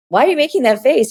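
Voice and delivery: high, fast, half-laughing why; puzzled quick ask. Retold or imitated. Asking Leo why he pulled a face, quick high puzzlement. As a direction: toss it back quickly, baffled. Reacting to Leo's "Ooh.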